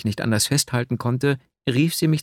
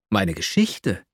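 startled; 4 semitones lower, slower than usual. A narrator's reading. Shy man asked for his life story, startled. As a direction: caught off guard, repeat it in alarm. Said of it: "rief ich erschrocken aus".

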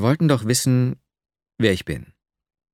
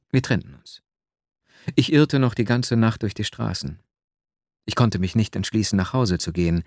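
plain reading voice

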